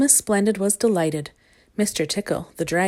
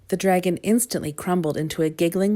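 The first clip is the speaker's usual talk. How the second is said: plain reading voice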